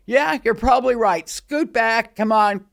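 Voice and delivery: a touch of a bandleader calling out; mock bandleader. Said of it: "the guy said".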